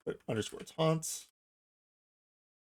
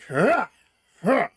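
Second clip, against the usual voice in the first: loud, higher-pitched effort grunts; power attack grunt; 8 semitones higher, 7 dB louder than usual. Shown as a demonstration. Demonstrating an NPC's heavy attack, intense combat grunt. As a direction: heave out two heavy-attack battle grunts. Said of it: "Power attack means that they're doing a heavy attack".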